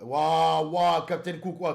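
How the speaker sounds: loud, drawn-out wow; shocked exclamation. Real, not acted. Streamer reacting to a crude chat message, shocked.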